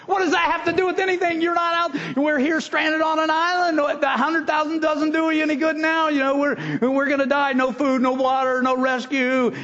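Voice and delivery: frantic disbelief, stranded and doomed; panicked castaway. Retold or imitated. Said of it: "guy says".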